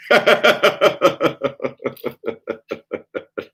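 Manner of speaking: a bright burst of laughter that fades away. Real, not acted. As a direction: laugh freely at your own joke. Laughs at his own trick.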